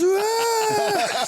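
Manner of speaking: a high, piercing imitated scream of a little boy; child's scream. Little Nathan frightened by the prank, imitated high scream. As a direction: shriek like a four-year-old jumping in fright. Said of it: "He just screams".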